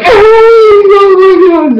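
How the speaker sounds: high, loud, drawn-out squeal; excited fan scream. Retold or imitated. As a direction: re-enact an ecstatic shriek at a favourite's return. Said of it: "That's when I got so excited".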